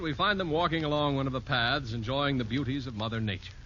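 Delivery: ordinary delivery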